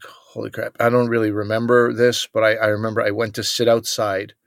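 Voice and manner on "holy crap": quiet realization. Retold or imitated. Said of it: "I'm like".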